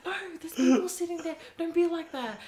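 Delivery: high, loud retold pleading; mock protest. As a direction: act out an urgent telling-off of the cousins. Acts out scolding the cousins back.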